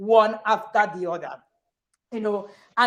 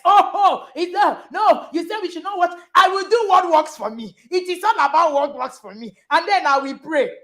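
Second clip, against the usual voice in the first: mock shouted complaint; 5 semitones higher than usual. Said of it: "people went".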